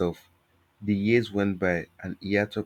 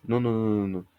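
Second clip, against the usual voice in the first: rapid repeated refusal, slightly raised; hint of panic; 3 semitones higher, faster than usual. A narrator's reading. Stylist stopping her customer taking the hair, mildly panicked. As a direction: let a touch of panic into the voice. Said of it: "her voice rising with a hint of panic".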